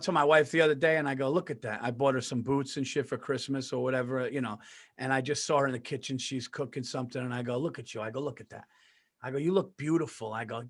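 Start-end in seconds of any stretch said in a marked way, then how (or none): 1.3-1.8 tender. Retold or imitated. Tenderly admiring wife in kitchen. 7.6-10.3 soft, hushed tender compliments; tender admiring. Retold or imitated. Admiring his wife in the kitchen, hushed and tender.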